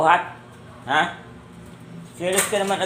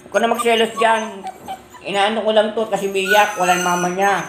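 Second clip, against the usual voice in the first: raised, high-pitched scolding; chiding; 6 semitones higher, 4 dB louder than usual. Real, not acted. Telling a jealous pet off for the fuss, chiding. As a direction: explain it loudly, pitched up.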